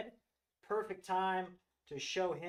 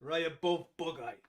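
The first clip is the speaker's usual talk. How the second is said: a hint of a deep Russian accent on Raya Bove; lightly put-on Russian accent, a touch lower; playful light Russian accent; mock Russian accent; 4 semitones lower than usual. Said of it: "You have to say it with a deep Russian accent".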